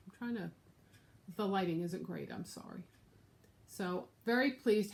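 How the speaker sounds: usual speaking voice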